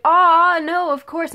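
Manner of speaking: high, loud excited "Aw"; delighted gush. Retold or imitated. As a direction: gush happily at the fan's request. Sabrina delighted by selfie request.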